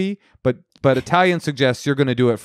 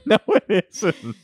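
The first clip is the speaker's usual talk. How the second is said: laughing; 9 semitones higher, slower than usual. Real, not acted. Laughing denial of friend's joke.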